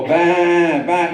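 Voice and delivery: drawn-out mocking baa; sarcastic sheep. Other sheep chiming in to mock the first, sarcastic bleating. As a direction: bleat slowly, taking the piss. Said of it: "completely taking the piss".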